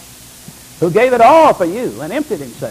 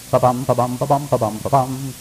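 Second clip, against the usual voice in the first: low walking rhythm; 5 semitones lower than usual. Retold or imitated. Imitating footsteps on a journey.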